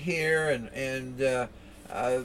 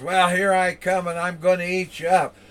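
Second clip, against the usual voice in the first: higher, louder troll, quick at the end; dopey cartoon bellow; 4 semitones higher, 5 dB louder than usual. Troll threatens the little goat.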